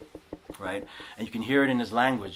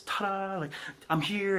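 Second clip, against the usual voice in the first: playful Jesus; 8 semitones higher, 4 dB quieter than usual. Retold or imitated. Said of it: "he just shows up and he says".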